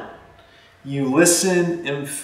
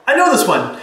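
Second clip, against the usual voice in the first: mock eager know-it-all; 10 semitones higher, 3 dB louder than usual. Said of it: "And you're like".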